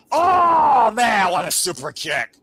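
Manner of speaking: sudden loud burst of excitement; excited shout. Real, not acted. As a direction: erupt at the big kick. Commentator reacting to a super kick.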